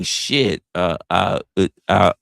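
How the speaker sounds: normal talking voice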